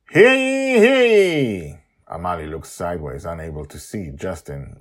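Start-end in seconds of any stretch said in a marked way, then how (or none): 0.1-1.8 loud high crier's call, hands cupped. Acted. Father calling everyone on deck, loud crier's call. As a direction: bellow it across the deck like a town crier. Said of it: "cups his mouth with his hands and calls".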